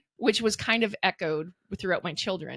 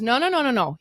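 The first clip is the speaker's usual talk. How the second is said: louder, higher no no no; firm insistence; 6 semitones higher, 6 dB louder than usual. Retold or imitated. Son insisting to his mom, firm. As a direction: cut her off firmly.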